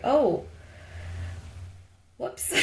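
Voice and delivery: sheepish realisation. Retold or imitated. Guest voices the customer realising her outburst, sheepish. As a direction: imitate someone sheepishly catching their mistake. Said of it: "be like".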